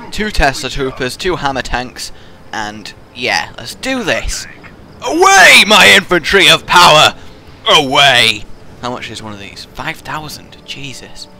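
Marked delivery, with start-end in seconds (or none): 5.0-8.4 mock commander. Commanding troops, hammed up.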